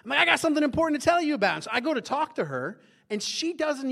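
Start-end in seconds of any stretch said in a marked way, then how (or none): 0.2-1.6 raised, loud, rushed interruption; eager announcement. Retold or imitated. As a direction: burst in with important news, fast and high. Eagerly interrupting wife with important news.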